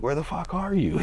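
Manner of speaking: imitating his furious older brother; pissed-off brother. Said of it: "my brother was so pissed".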